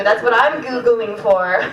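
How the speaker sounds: ordinary talk, nothing marked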